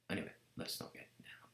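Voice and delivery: whispered throwaway aside. Real, not acted. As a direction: breathe it as a private aside. Aside dropping out of the story.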